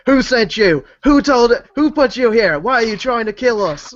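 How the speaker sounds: high, loud furious demands; yelling interrogation. Acted. Interrogator shaking the prisoner, yelling the questions. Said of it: "I yell at him".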